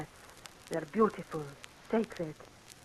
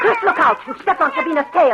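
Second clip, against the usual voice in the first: sudden raised alarm, higher and louder; sharp warning cry; 8 semitones higher, 8 dB louder than usual. Acted. Wife alarmed as husband steps on her cat, loud warning. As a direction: call out to stop him in his tracks.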